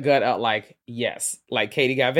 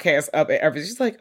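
usual speaking voice

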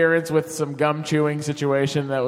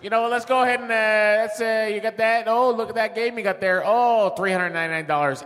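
lightly voiced Chicago salesman, higher; a hint of a Chicago TV pitchman; Chicago accent; 6 semitones higher than usual. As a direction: pitch it like a gum-chewing Chicago dealer. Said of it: "Now do the Chicago accent".

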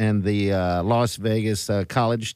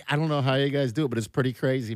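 usual speaking voice

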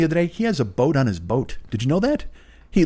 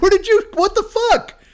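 mock outrage; 3 dB louder than usual. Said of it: "I'm like".

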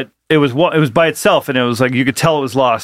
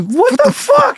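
very high-pitched, drawn-out disbelief; incredulous outburst; slower than usual. Retold or imitated. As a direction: squeal it in mock outrage, stretching the words. Retells reaction to slammed doors.

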